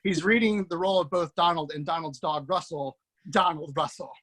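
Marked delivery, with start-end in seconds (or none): none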